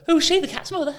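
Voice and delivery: squeaky high mocking voice; cheeky high mock. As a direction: pipe it up high like a cheeky kid. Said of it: "I would go".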